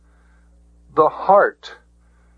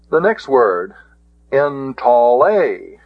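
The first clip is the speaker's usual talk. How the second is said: ordinary talk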